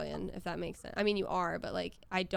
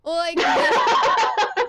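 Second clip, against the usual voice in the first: high, loud laughing through words; 13 dB louder, much slower than usual. Real, not acted. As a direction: laugh while saying it. Laughing mid-sentence.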